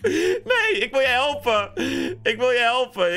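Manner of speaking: high laugh breaking into the words; laughing protest. Real, not acted. Player wants to help Fred despite giggles, laughing protest. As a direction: plead through real laughter.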